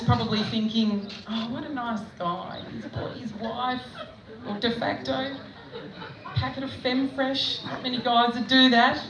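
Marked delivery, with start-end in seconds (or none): 1.3-7.6 softly voiced imagined thought; soft admiring thought. Checkout chick imagining a nice husband, softly admiring. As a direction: think it aloud, softer, in an admiring way.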